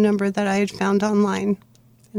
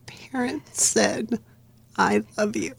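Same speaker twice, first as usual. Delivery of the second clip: slow, softer, almost whispered with emotion; choked up; 5 dB quieter, slower than usual. Real, not acted. Daughter recalls a parent's first "I love you", choked up. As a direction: barely get the words out.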